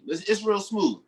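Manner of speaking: ordinary talk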